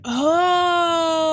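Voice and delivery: high loud sudden cry; surprised shout. Real, not acted. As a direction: burst out with realization.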